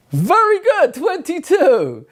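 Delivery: high, squeaky put-on praise; mock praise voice. Teacher praising the children in a silly high voice. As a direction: chirp the praise like a cartoon helper.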